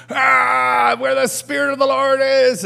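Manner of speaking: high, loud war cry held all through; Braveheart battle yell. Acted. Blue-painted guys running in with swords, battle yell. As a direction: charge in roaring the verse at high pitch. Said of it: "they're up and running".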